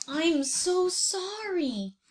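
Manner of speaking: a gentle, slow apology; sorry horse. Acted. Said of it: "said Honey Horse".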